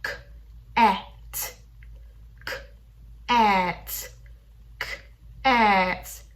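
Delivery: "k", "at" stretched then sped up; sounding out words. Shown as a demonstration. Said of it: "stretching out the sounds and speeding up".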